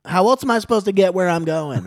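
high, loud imitation of a woman arguing; retold shouted comeback; mock indignant retort. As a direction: fire back in her exasperated voice. Acts out woman's indignant reply.

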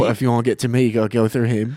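hammed-up British guy; playful fake British; British accent. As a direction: put on an amateur British accent. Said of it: "I'll be a British guy".

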